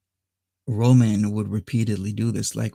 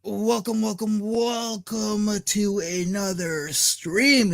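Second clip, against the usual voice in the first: hyped stream intro; 9 semitones higher than usual. Real, not acted.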